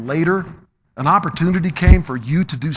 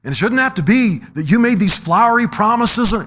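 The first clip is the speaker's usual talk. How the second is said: normal talking voice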